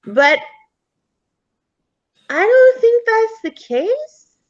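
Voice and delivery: put-on high, boyish explaining voice; chatty ghost boy. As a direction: explain it like an earnest kid.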